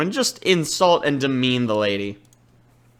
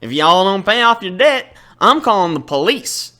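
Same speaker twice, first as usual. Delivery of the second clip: gruff Southern shopkeeper, a little louder; Southern accent; 3 semitones higher, 4 dB louder than usual. Acted. Southern chef grudgingly sets the debt terms, Southern accent. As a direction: grumble it out like a Southern diner owner. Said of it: "a Southern accent".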